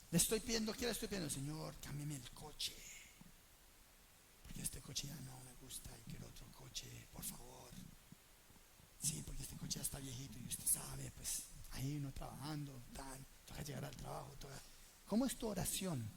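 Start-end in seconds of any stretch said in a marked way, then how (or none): 1.3-7.7 hushed, whispered pleading for a new car; mock selfish prayer. Preacher mocking a selfish prayer to the Lord, hushed and whining. 9.0-14.6 soft, lower grumbling justification; mock excuse. Preacher imitating a believer excusing his car request, soft and low.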